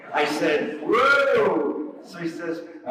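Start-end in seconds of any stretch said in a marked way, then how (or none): 0.8-1.9 surprised whoa. Retold or imitated. Hearing of the alligator.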